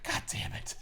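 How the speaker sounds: breathy unvoiced curse under the breath; muttered curse. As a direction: mutter it to yourself. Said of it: "And he's like".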